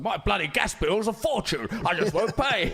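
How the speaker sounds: high, loud mock grumble of an old poet; imitating Larkin. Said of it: "Philip Larkin was like".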